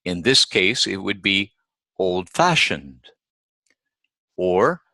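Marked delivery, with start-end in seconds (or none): 2.0-3.0 put-on old-fashioned with FASH stressed; old-fashioned, weight on the second part. Shown as a demonstration. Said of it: "it is the second part".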